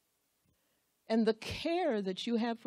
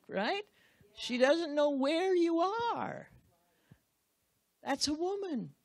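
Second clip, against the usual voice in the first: ordinary delivery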